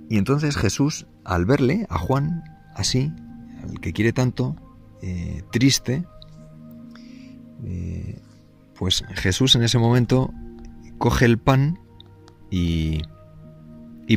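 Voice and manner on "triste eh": ordinary talk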